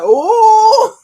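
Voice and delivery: loud, high re-enacted oh; hammed-up high burst of delight; delighted squeal. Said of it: "I was like".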